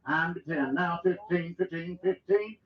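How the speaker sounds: rapid rhythmic auction chant; auctioneer. Acted. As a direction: roll the numbers like a bid caller. Livestock auction bid calling.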